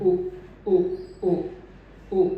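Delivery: उ repeated with rounded lips; vowel demo. Shown as a demonstration. Teacher repeats the closing उ sound. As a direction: model the उ again. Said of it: "वो sound है again वही sound".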